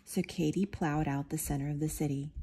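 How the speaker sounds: ordinary delivery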